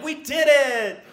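high, slow, drawn-out victory shout; celebrating pulling it off; triumphant cheer. Retold or imitated. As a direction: savour it like a win. Triumphant after getting dressed.

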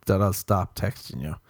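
ordinary delivery

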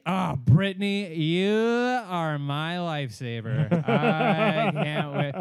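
put-on slow, loud drunken gush; slurring drunk friend. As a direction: draw it out like a sloppy drunk. Drunk friend gushing slowly.

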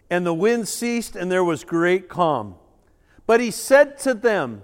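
usual speaking voice

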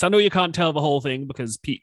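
normal talking voice

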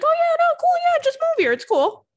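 high, loud, airy put-on voice; breezy mock promise. Mimicking someone promising a move from far away, breezy and high. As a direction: toss off an easy promise in a squeaky mock voice.

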